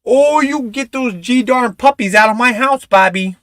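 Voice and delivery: high, slow Minnesota mom scolding; scolding Bobby in a Minnesota accent. Retold or imitated. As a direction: Minnesota mom shooing puppies out, high and drawn out. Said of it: "she said it all the time".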